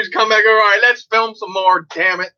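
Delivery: mock impatient brother; hammed-up eager filmmaker; imitating brother. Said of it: "You probably been there, huh, Paul?".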